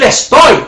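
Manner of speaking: estoy set apart and stressed. Shown as a demonstration. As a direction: land hard on the word estoy. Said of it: "pero decir".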